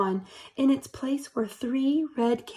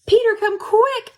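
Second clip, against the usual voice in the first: high, loud urgent call; shouted call; 10 semitones higher, 6 dB louder than usual. Acted. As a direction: shout for your brother to hurry. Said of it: "I shouted".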